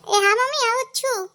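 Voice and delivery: high, loud answering call. Acted. Daughter-in-law calling back to mother-in-law, high loud. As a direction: shout back sweetly from afar.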